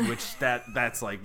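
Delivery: ordinary talk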